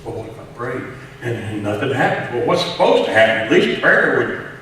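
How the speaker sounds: impassioned pulpit fervor; full-force preaching. Acted. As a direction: preach it hard to the congregation.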